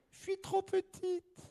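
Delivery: high, soft little voice; tiny candle voice. Acted. The little tealight candle refusing the hiker, high and soft. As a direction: sound small and sorry.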